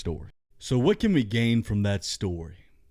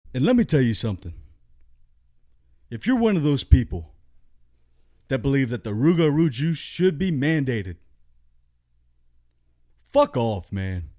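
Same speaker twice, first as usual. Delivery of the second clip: louder, fast, heated rant; fired-up rant getting louder; angry rant; 4 semitones higher, 4 dB louder than usual. Real, not acted. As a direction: tell them off with rising anger. Ranting at vaccine supporters.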